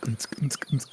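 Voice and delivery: soft, rhythmic club-beat noise; imitated dance beat. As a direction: pulse it out like muffled dance music. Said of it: "the bird's just like".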